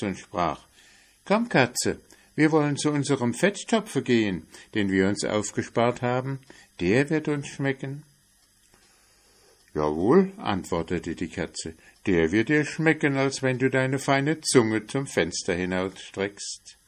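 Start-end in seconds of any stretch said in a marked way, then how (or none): 1.2-8.0 higher, quick, happy mouse; eager mouse, brisk and higher; cheerful mouse. Acted. Mouse invites cat. 9.7-16.8 louder, sarcastic cat; a hint of a taunting cat; mocking cat. Cat mocks the mouse.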